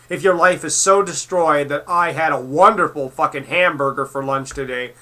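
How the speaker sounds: ordinary delivery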